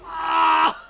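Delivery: loud, high scream; sudden high yelp at the game; startled scream. Real, not acted. Startled while playing the game.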